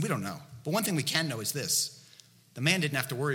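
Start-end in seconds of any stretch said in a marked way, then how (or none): none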